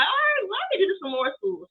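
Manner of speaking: pleased self quote. Retold or imitated. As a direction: lift the voice, getting an idea. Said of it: "I was like".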